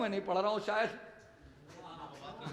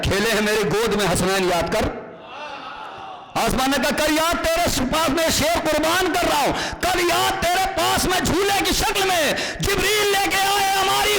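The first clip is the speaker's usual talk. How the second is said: poetry recitation; 7 semitones higher, 5 dB louder than usual. Acted. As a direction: build each line towards the audience. Recites poem to audience.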